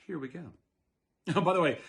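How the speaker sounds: plain reading voice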